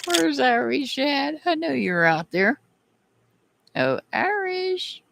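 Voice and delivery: high singsong call into the room. Real, not acted. Calling out for absent viewer.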